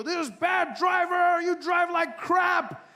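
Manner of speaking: high, loud, slow furious yell; road rage shout. Retold or imitated. Driver yelling at swerving car, high loud road rage. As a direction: bellow it out the window.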